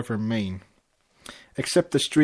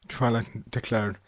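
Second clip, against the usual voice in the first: usual speaking voice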